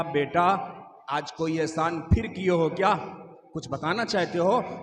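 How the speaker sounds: a mother gently asking her son; gentle mother voice. Acted.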